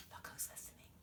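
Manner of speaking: secretive storyteller whisper. Acted. Storyteller pointing out the birds, hushed whisper. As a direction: hush it like a secret for the child.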